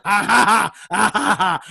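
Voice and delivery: hammed-up Muppet cackle; mock laughter. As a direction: laugh it out like a puppet. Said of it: "you see them through the car going".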